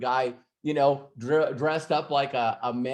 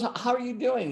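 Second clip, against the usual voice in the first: higher, stumbling greeting, caught off guard; secretary flustered seeing Robert dressed up, surprised; flustered surprise; 10 semitones higher, faster than usual. Retold or imitated.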